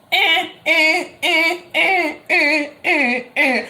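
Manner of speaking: sung high buzzer noise, repeated; imitated intercom buzz; buzzer imitation. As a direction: mimic the door buzzer in a tuneless high drone.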